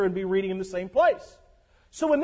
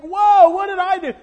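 louder, higher, taken-aback cry; startled reaction; 7 semitones higher, 8 dB louder than usual. Retold or imitated. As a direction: recoil as if pushed away. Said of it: "people look at us like".

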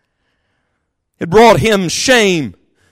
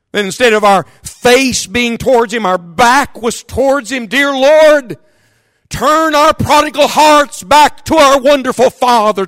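higher, shouted pleading prayer; fervent prayer; 7 semitones higher, slower than usual. Acted. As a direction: cry out to God for the wandering. Prayer at full force.

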